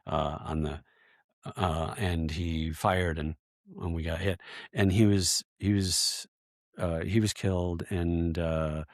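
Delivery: low, soft, halting recollection; real subdued grief. Real, not acted. Veteran recalling his friend's death, real subdued grief. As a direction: drop the voice and falter between phrases.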